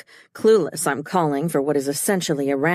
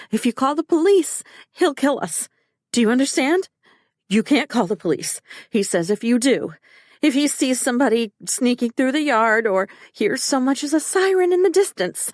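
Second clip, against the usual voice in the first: higher, pleading panic; frantic; 6 semitones higher than usual. A narrator's reading. Wife warns husband about police, frantic and higher. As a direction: beg him not to call police.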